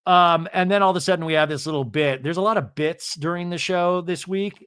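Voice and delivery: ordinary delivery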